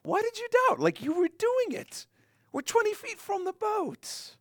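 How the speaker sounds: high, playful mock exasperation; Jesus telling Peter they were so close; Jesus teasing Peter. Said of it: "He says".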